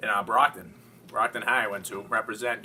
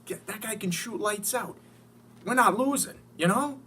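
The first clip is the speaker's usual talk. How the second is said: much higher, thrilled and giddy; excited disbelief; 11 semitones higher than usual. Retold or imitated. Fan retelling his call with Donnie, squealing with excitement. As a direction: gush like you can't believe the trade. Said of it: "I'm like".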